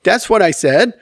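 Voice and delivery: high, breezy imitation of a wife; lightly voiced agreeable wife; wife, readily agreeing. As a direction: mimic easy agreement, pitched well up. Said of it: "she'll be like".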